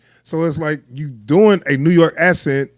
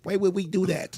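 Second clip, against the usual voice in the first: softly mimicked whiny character line; mock his voice under your breath; mocking movie character; 11 dB quieter, faster than usual. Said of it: "I don't like his voice at all".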